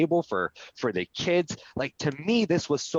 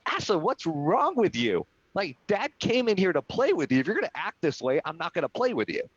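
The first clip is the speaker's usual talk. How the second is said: higher-pitched, mildly exasperated dad; almost upset; 6 semitones higher than usual. Retold or imitated. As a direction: re-enact a father starting to scold his child. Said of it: "I almost got upset".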